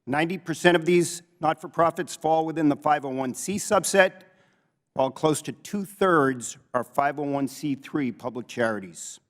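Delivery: ordinary talk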